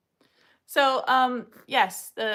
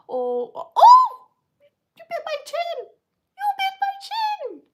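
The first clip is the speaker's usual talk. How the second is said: very high, loud surprised cry; very high startled complaint to puppy; startled yelp; 10 dB louder than usual. Real, not acted. Puppy bites her chin.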